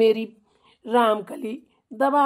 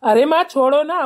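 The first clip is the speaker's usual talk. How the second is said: loud plea to drop it; calling out; 3 semitones higher, 11 dB louder than usual. Real, not acted. Son loudly tells his mother to let it go. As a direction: cut in loudly to calm her.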